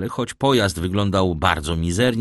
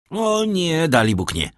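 high cry of refusal, settling at the end; exclaimed protest; 9 semitones higher than usual. A narrator's reading. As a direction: protest with a leap in pitch. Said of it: "wykrzyknął Albert". (wykrzyknięty sprzeciw)

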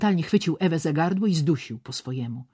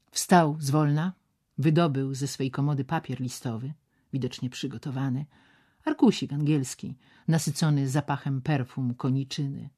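usual speaking voice

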